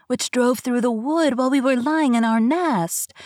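high, slow cooing bird voice; wood pigeon. Acted. Wood pigeon cooing to Gerda about Kay, high and slow. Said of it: "Then the wood pigeon said".

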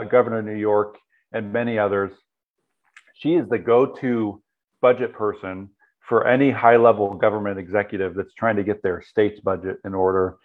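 ordinary talk, nothing marked

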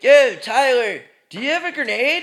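soldier asks Tyler for a grenade, hammed-up bro voice; mock soldier bro. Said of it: "They'd be like".

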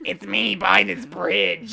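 slow, drawn-out comic character voice; Missus Bighead-like voice. Acted. Host joking as a cutout selling a bridge, put-on cartoon voice. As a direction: stretch it out like a cartoon sales pitch. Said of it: "Sounds like you're doing ... Missus Bighead".

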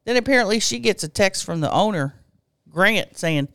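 ordinary delivery

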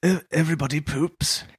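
lightly posh English prince voice; a hint of royal poshness, softly; posh Harry voice. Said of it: "in that posh voice of his".

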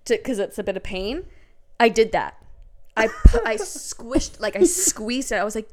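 ordinary talk

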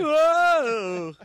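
long, high shouted whoa; loud whoa. Real, not acted. Reacting to the co-host's massacre pun, loud high whoa. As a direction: holler a drawn-out whoa.

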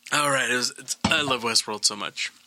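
ordinary talk

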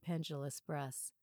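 ordinary delivery

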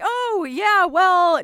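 drawn-out, play-acted reply to a husband; mock retort. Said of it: "And I'm like".

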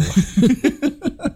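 high-pitched burst of laughter. Real, not acted. Laughing at pirate bit.

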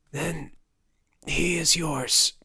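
slow, whispered rasp; dying rasp. A narrator's reading. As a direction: hand over a last gift on failing breath. Dying father gives son his wolf.

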